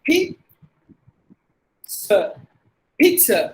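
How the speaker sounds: pizza as PEET-suh; no Z sound. Shown as a demonstration. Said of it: "This is how we have to pronounce".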